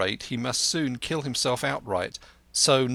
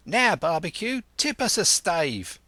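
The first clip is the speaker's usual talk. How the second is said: higher, cheerful sailor's call; a lightly voiced rough sailor; 6 semitones higher than usual. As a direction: rouse the cook to sing. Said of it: "cried one voice".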